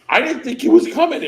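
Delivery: high, fast and loud; excited. Real, not acted. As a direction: rush it out in delight. Excited retelling of surprise.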